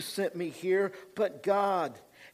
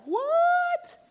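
much higher, stunned squeak of disbelief; a far higher, slowed cry of utter shock; 7 dB louder, much slower than usual. Retold or imitated. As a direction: gasp it out like you can't believe who's standing there. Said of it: "They're like".

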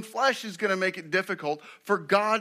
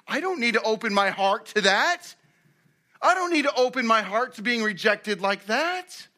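higher voice, rising at the end; mock indignant refusal; 6 semitones higher, 4 dB louder than usual. Rejected confessor closing his heart, mock indignant refusal. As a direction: protest defensively, pitch climbing on each sentence.

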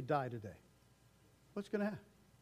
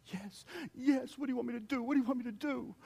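high, eager pleading; like a keen child begging for a task; higher eager voice of a willing believer; 8 semitones higher, faster than usual. Retold or imitated. Eager believer answering God.

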